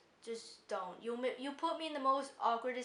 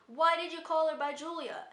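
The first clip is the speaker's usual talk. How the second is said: higher, louder mock classmate; imitated blurted question; 6 semitones higher, 7 dB louder than usual. Classmate challenges substitute over the name, imitated loud blurt.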